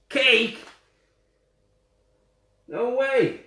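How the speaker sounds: loud, high-pitched disbelief; delighted shock at a story twist; astonished. Real, not acted. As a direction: exclaim it in amazement, pitch leaping up. Reader reacts to the story's surprise.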